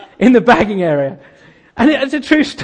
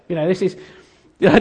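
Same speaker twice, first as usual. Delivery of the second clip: usual speaking voice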